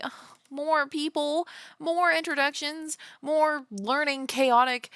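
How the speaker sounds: high, drawn-out mock dread; exasperated groaning. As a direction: groan through each item, pitched right up. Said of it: "and I'm like".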